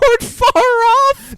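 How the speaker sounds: a sudden high-pitched yell of protest; loud high exclaim. Real, not acted. Arguing with his cohost, a high shout. Friends mock-arguing about the movie.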